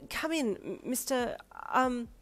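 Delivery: high, hesitant invitation; stammering mum; flustered welcome. Acted. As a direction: falter politely, unsure of the name. Flustered mum greets strange guest.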